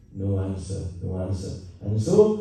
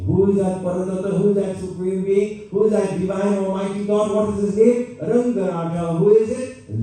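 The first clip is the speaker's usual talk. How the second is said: higher and louder, rousing the crowd; preaching calls; 9 semitones higher, 4 dB louder than usual. Acted. Preacher rousing the devotees with questions, fervently. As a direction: fire each question at the devotees.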